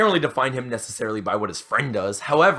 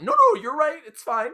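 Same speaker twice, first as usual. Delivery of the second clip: much higher, squeaky mock backing-down; 12 semitones higher than usual. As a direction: imitate her, pitched way up and sheepish. Girl backing down, mock high.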